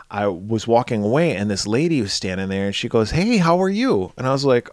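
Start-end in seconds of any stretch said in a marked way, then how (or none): 3.1-4.1 friendly stranger. Retold or imitated. Woman approaches him at casino.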